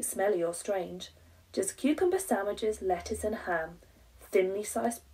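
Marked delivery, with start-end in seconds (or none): none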